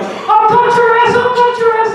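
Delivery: hammed-up shrill little-girl threat; wicked, vicious little girl. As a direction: screech the threat like a tiny menace. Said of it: "she's got this wicked, vicious attitude".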